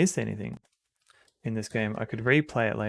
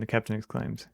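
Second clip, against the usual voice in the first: usual speaking voice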